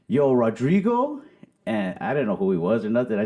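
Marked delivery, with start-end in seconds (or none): 0.1-1.2 slightly raised, slow teasing call; playful. Retold or imitated. Tipsy comic teases a stranger who greeted him, playful. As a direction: draw out a mock guess at the name. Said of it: "in a playful mood".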